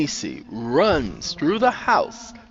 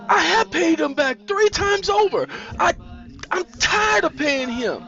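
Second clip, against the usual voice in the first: exasperated protest. A narrator's reading. Nisi answering Sandra about paying Henrik, exasperated.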